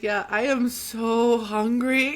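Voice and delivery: imitating a hungry guard, words dragged out; complaining guard. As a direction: stretch out the hunger like a hammed-up complaint. Acting the fasting guard's complaint.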